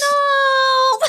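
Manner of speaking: high, drawn-out wail of no; long high-pitched objection; loud protest. Real, not acted. Mock protest at friend's remark.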